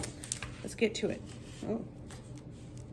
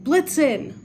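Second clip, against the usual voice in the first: higher and louder shout; shouting a name; 6 semitones higher, 7 dB louder than usual. A narrator's reading. Gentleman calls his runaway reindeer, loud shout. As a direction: call after the reindeer at full voice. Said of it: "shouted the stout gentleman".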